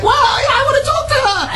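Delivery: high, drawn-out fan squeal; mock freaking out. Host imitates the caller freaking out, mock squeal. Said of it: "You must have been freaking out".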